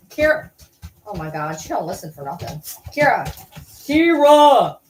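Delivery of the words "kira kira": loud, rising call of a name; calling a stubborn dog, louder each time. Real, not acted. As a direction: shout the dog's name across the house.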